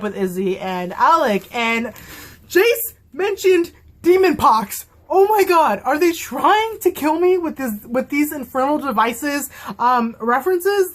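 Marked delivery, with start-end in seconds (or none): 2.5-4.9 high, drawn-out excited cry; breaking into a delighted squeal; fangirl squeal. Real, not acted. 5.1-10.9 overwhelmed fan. Real, not acted. Fangirl overwhelmed.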